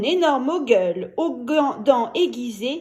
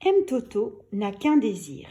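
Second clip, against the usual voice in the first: usual speaking voice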